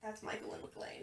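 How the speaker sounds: hushed whispered aside. Real, not acted. Two friends bickering about cousins, whispering. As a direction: drop to a whisper.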